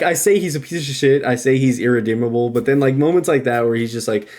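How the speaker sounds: normal talking voice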